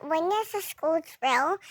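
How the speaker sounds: ordinary talk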